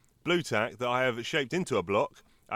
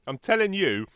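advert pitch; 5 semitones higher, 6 dB louder than usual. Pretend. Hypes co-host like an advert.

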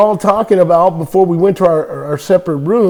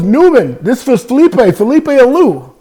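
put-on Spanish accent; 7 semitones higher than usual. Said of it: "He goes".

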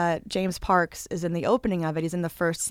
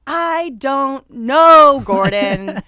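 slow, louder, stretched-out exasperation; exasperated drawn-out reply; 4 semitones higher, 8 dB louder, slower than usual. Retold or imitated. Retelling answering a pestering friend, slow and exasperated. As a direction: drag it out, fed up. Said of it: "And I go".

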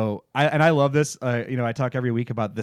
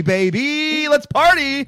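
party hype; 8 dB louder, slower than usual. Pretend. Hyping Miami montage.

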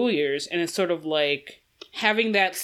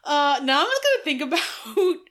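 giggling through the words; high breathless laughter while talking; laughing; 11 semitones higher, slower than usual. Real, not acted. Laughing fit mid conversation.

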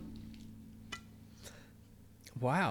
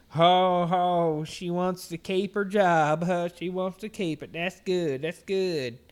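loud, raised jeering customer voice; hammed-up, higher-pitched taunt; mock taunting; 7 semitones higher, 8 dB louder, slower than usual. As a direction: jeer like a customer teasing the cashier. Acts out the mocking customers.

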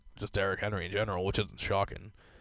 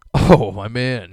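excited drawn-out greeting; 11 dB louder, much slower than usual. Real, not acted. Excitedly introduces sports figure.

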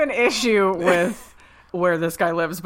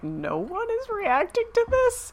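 much higher, disbelieving question; can't believe nobody notices; pitched way up in disbelief; incredulous; 3 dB quieter than usual. Retold or imitated. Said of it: "I'm like".

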